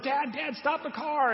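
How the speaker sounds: put-on high, urgent little kid's plea; child begging. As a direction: beg like a small child in the back seat. Child begs father to stop car.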